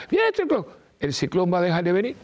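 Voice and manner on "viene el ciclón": high-pitched alarmed cry of a panicking person; mock panicked yell. Imitating a panicking person crying out about the hurricane, mock alarmed yell. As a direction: shriek the warning like someone losing their head. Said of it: "empiece a gritar".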